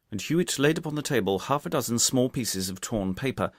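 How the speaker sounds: plain reading voice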